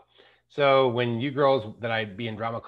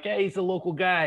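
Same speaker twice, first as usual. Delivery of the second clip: put-on jokey Boston accent, pitched up; 6 semitones higher than usual. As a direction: play the Boston local with a light put-on Boston accent. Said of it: "I'll get my accent dialed in".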